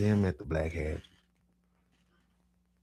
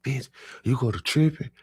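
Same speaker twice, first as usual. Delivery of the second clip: sharp, raised and higher; mad at cousin; 7 semitones higher, 5 dB louder than usual. Retold or imitated. Narrator yells at his high cousin, angry. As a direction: snap at him for tripping. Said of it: "So I get mad".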